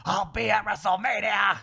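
loud, high promo shout; fired-up wrestler. Retold or imitated. Host imitating a fired-up wrestler's challenge, shouting. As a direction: holler it like a wrestler's vow. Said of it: "Seth Rollins got fired up".

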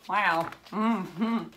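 ordinary delivery

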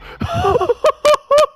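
high shrieking laugh; wild laughter. Real, not acted.

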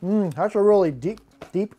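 chewing a chip mid-sentence; talking while chewing. Real, not acted. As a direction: mouth partly full of chips. Seen 0: Bites chip at -5; mouth still working early in words.

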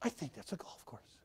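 whispered aside in the fog; hushed realization. Retold or imitated. Preacher whispering his discovery to the congregation. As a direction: breathe it out, barely voiced.

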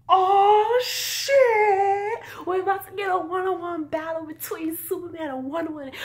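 loud and high, thrilled; yelling with excitement at the screen; excited shout. Retold or imitated. Fan hyped at the screen, an excited high shout. Reacting to the fight scene.

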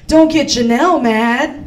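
ordinary talk

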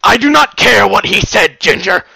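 loud, high, furious shouting; furious roar. Acted. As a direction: roar the threat at her. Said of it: "with incredible fury".

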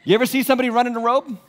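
normal talking voice

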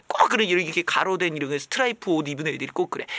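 ordinary talk

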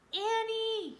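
high, loud, drawn-out call; desperate shout. A narrator's reading. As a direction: shout her name into the dark. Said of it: "he shouted".

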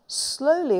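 usual speaking voice

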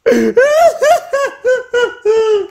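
high burst of laughter. Real, not acted. Bad pull, big laugh.

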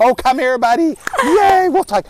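high and loud, playful coaxing; silly dog voice. Real, not acted. Handler inviting his dog to tug, high silly voice. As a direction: get the dog excited to play tug. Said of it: "talking in a silly voice like this".

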